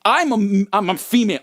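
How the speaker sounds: a touch of offended teacher; indignant teacher. Retold or imitated. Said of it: "And she goes".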